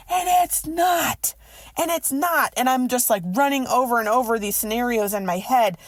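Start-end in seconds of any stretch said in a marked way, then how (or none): 0.1-2.5 exasperated, raised voice; frustrated outburst. Retold or imitated. Frustrated outburst about late friends.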